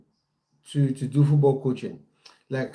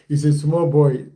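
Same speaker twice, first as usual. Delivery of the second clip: louder second read through a laugh; laughing repeat; 5 dB louder than usual. Shown as a demonstration. Streamer repeats the viewer's joke louder, laughing. Repeating a chat comment twice.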